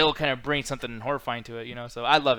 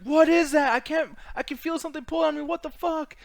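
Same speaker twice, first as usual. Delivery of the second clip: panicked victims; 12 semitones higher, 5 dB louder than usual. Retold or imitated. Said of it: "they're reacting like".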